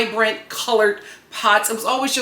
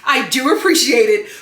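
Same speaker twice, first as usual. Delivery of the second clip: ordinary delivery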